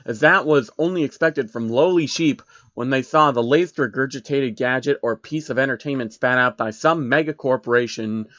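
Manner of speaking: ordinary delivery, nothing marked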